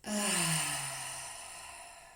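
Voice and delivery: drawn-out breathy sigh of release; long exhaling sigh. Shown as a demonstration. Guide repeating the mouth exhale for listeners, long sigh. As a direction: breathe it all out audibly. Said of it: "We'll do that two more times".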